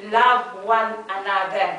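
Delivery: slow and deliberate, each word weighted; solemn quote. Acted. Quoting the Lord to the congregation, slow and solemn. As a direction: lay the Lord's words down slowly.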